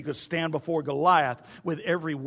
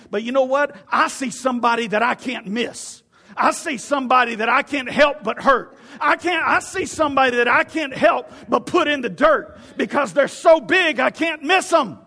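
excited David; 5 semitones higher, 6 dB louder than usual. Acted. David boasting to Saul about the giant, triumphant yell. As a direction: build to a high, full-throated preaching shout. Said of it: "why I'm so excited".

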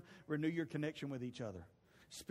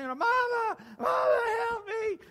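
hammed-up high, loud wail of a terrified kid; screaming and hollering; 8 dB louder than usual. Boy begs mama for help from the dog, screaming. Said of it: "he was screaming, holler, screaming, holler".

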